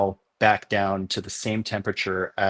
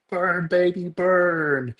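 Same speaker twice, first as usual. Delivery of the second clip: high, drawn-out mock scream at ordinary volume; high-pitched imitation of screaming, held long; retold gleeful scream; 8 semitones higher, much slower than usual. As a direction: imitate excited news guys cheering on a blaze. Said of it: "they start screaming".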